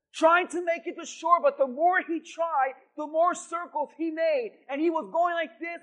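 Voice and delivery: ordinary delivery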